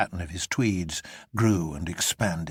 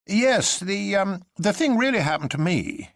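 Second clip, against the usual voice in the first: much higher and louder, blurted out in alarm; hasty Syme; 12 semitones higher, 5 dB louder than usual. A narrator's reading. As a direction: rush in with nervous bravado. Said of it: "he said hastily".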